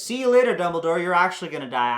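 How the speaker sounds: high, loud, gloating goodbye; mock triumphant farewell. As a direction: shout it as a cocky send-off. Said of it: "He says".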